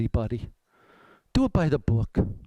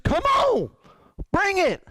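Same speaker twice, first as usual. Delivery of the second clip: defiant challenge; 10 semitones higher, slower than usual. Retold or imitated. Voicing defiant perspective.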